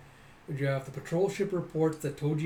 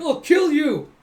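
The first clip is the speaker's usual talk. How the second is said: high, very loud threat; enraged mock yell; 13 semitones higher, 14 dB louder, slower than usual. Fighter to pranksters who doodled on his face, mock-furious yell.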